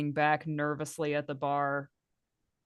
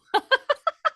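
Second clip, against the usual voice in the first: high squealing laugh; 5 dB louder than usual. Real, not acted. Laughing at the spear.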